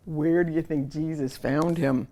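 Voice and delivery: plain reading voice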